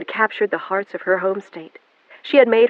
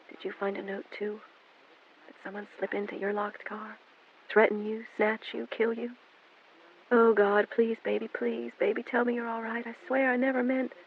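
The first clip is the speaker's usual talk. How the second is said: softer, hushed frantic inner fear; panicked inner thoughts; 5 semitones higher, 8 dB quieter than usual. A narrator's reading. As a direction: pray it under your breath in panic.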